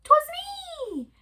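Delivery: meerkat voice. Acted. Meerkat answers from behind wall.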